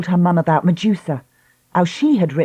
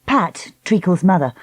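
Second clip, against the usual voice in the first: ordinary delivery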